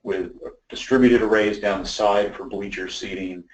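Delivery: normal talking voice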